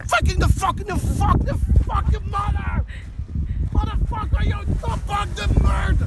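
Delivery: slow, loud, high hammed-up insults; mock angry yelling. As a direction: yell insults in a drawn-out rant. Mock insults yelled in English.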